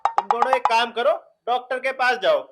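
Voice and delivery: loud, insistent advice; loud bossy instructions. Acted. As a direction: tell them off loudly, as if they are the problem. Loudly sends both women to doctor.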